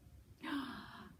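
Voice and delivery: soft breathy surprised gasp. Acted. Teacher gasps to build suspense for kids. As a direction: gasp as if about to reveal a secret.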